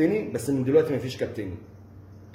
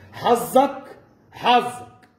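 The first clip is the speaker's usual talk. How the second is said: higher and louder, stressed; heated outburst; 6 semitones higher, 4 dB louder, much slower than usual. Real, not acted. Warning the rival he was lucky, loud. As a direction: hammer the word home.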